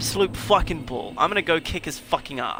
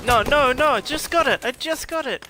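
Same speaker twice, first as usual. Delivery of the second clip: frustrated yell; 9 semitones higher, 4 dB louder than usual. Real, not acted. Frustrated gamer yelling at death.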